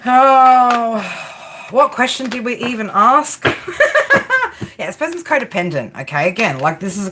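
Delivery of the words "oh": loud, higher drawn-out "oh"; long exasperated groan. Real, not acted. Card reader fed up with the reading, exasperated groan. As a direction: let out a big long groan.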